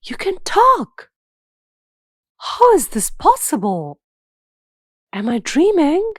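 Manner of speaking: high-pitched amazement in short bursts; boy astonished by what he hears; startled. A narrator's reading. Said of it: "Karthik was startled".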